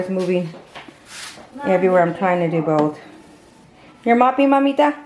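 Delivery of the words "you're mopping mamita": high, fond question to the dog; pet talk. Real, not acted. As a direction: ask the dog sweetly, pitched up. Talking to the dog.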